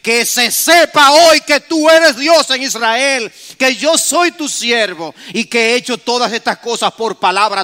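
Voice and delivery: loud, slow impassioned plea to God; fervent prayer. Retold or imitated. Preacher voicing Elijah praying before the people. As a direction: pray aloud with full fervour. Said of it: "Elías se acercó y dijo".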